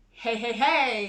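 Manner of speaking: mild snarl in a wolf voice; lightly voiced wolf snarl. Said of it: "he snarled".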